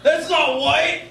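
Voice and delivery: mock indignant call across the crowd; accusing call-out. Acted calling out of odd one.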